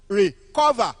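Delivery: "Recover" loud, with CO stressed; emphatic "Recover", weight on CO; second-syllable stress. Shown as a demonstration. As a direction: punch the middle of "Recover". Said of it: "As I pronounce them".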